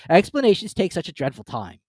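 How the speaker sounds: impatient Griffin. A narrator's reading. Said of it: "in an impatient tone".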